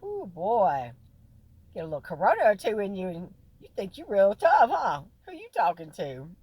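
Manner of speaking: loud teasing challenge; loud sassy taunt. Retold or imitated. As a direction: call him out, mock tough. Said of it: "I was like".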